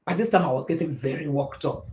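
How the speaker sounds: usual speaking voice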